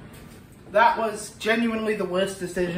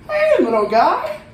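put-on high, slow baby-talk coo; 10 semitones higher, slower than usual. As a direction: coo at it like a tiny pet. Talking cutely to the pepper.

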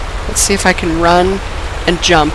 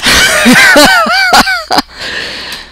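laughing. Real, not acted. Gamer cracks up at own failure, high cackle. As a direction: burst into high-pitched laughter trailing off.

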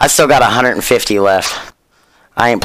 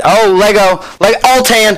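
high-pitched burst of real excitement; excited celebration; 10 semitones higher than usual. Real, not acted. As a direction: pitch leaps up as you see the lucky pull. Celebrates a lucky game pull.

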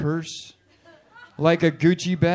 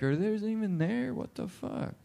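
hushed disbelief; 3 semitones higher, 10 dB quieter than usual. Retold or imitated. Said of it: "I was like".

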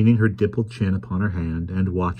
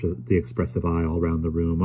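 usual speaking voice

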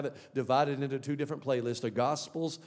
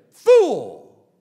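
very loud, falling condemnation; thundering rebuke; 4 semitones higher, 15 dB louder than usual. Acted. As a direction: shout it as God's verdict. God's rebuke to rich man, acted.

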